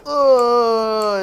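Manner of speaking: high, drawn-out oh; mock pain cry. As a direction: wail it falling from high. Rabbit's neck is broken.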